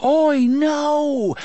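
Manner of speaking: high, drawn cry; high desperate yell; distant scream. A narrator's reading. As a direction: cry out high, as if heard through doors. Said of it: "Roland heard it faintly".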